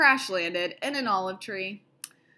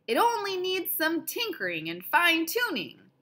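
hollered; 7 semitones higher than usual. Acted. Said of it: "Leon hollered".

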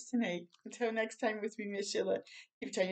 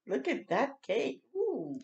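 plain reading voice

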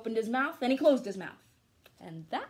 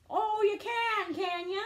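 mocking farmers; 10 semitones higher, 4 dB louder, slower than usual. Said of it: "said the farmers".

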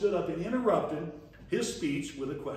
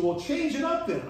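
son's voice; 9 semitones higher, 4 dB louder than usual. Retold or imitated. Son voiced in retold story.

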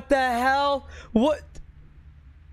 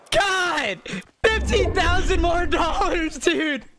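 shouting in disbelief at the donation; stunned shout; 3 semitones higher, 3 dB louder than usual. Real, not acted. Streamer shouting at a huge donation, stunned. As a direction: yell it out, overwhelmed. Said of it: "I don't know what to do".

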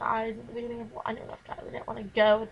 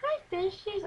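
put-on much higher baby-talk hello; cutesy greeting to fish; 9 semitones higher, slower than usual. Girl greeting her pet fish, high cooing baby talk. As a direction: coo at the fish like pets. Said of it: "I act like they were talking".

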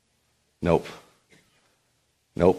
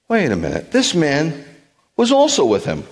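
voiced girl's accusation rising high; servant girl accusing; 8 semitones higher than usual. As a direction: point him out in rising alarm.

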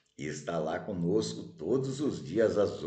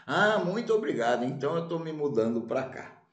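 delighted thanks; 6 semitones higher than usual. Acted. Wanderer thanks the sage.

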